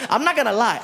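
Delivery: usual speaking voice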